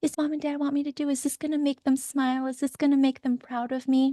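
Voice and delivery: lightly voiced anxious child. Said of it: "your wounded child".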